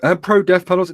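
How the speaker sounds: high, loud mock whine in imitation. Imitating people who bring that argument up, mocking. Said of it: "I hate when people bring that up".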